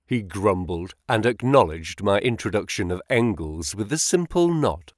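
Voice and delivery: usual speaking voice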